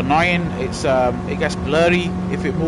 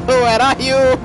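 louder call; 8 semitones higher, 5 dB louder, much slower than usual. Real, not acted. Calling again, louder.

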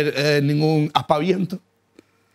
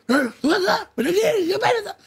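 high-pitched hammed-up owner insisting; mock frantic yelling. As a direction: imitate the owner yelling, half laughing. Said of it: "Y el tío gritó tanto".

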